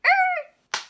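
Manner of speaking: high, loud strangled er; frustrated growl. Retold or imitated. Teller groans as customer turns back, loud and high. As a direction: burst out in annoyance. Mock outburst as customer stops.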